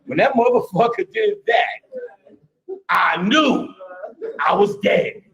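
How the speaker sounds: slow, high retelling of near death; lightly voiced scared guy. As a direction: play him shaken but laughing. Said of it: "he's like".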